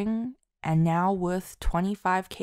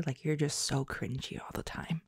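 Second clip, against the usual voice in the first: whispered; 3 semitones lower, 7 dB quieter than usual. Real, not acted. Self-deprecating aside.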